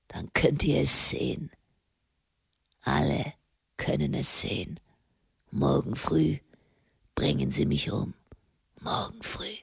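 low, soft, fading to a whisper; voice trailing away. A narrator's reading. Condemned man facing execution tomorrow, voice fading away. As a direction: let the words die away. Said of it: "Seine Stimme verlor sich".

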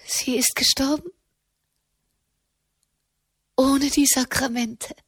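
high, stunned questioning; fassungslos. A narrator's reading. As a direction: ask in shocked disbelief, voice lifted. Tochter erfährt vom Tod der Mutter. (fassungslose, hohe Nachfrage)

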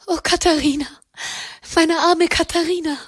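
high, crying out in grief; tearful lament. A narrator's reading. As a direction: wail her name from tears. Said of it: "mit rot geweinten Augen". (hohe, weinerliche Klage um Katharina)

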